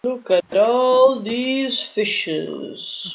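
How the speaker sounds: very slow, drawn out. Acted. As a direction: drag each word out slowly. Slow quote line.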